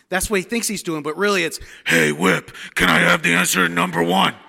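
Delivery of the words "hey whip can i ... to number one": a loud, raspy stage whisper. Retold or imitated. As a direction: rasp it out loud, thinking it's a whisper. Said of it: "All raspy like that".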